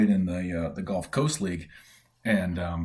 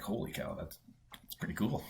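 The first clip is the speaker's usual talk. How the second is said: hushed, breathless holy cow; awed whisper; 12 dB quieter than usual. Retold or imitated. Told he was first Canadian, whispering in awe. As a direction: whisper it in quiet disbelief.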